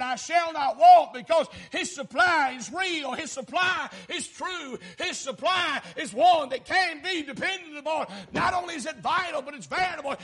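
loud, rising preacher's shout; hollering gospel preaching; full-force preaching. Acted. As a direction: preach it to the rafters.

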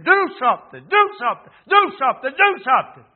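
high, loud repeated demand; shouted plea, punched hard each time; urgent inner cry. Acted. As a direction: cry out insistently, rising from within. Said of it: "was coming up, saying".

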